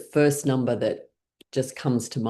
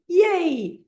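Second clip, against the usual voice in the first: excited cheer. Shown as a demonstration. Teacher shows the class an excited yay, cheering high. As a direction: throw the arms up and cheer. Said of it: "Do that with me".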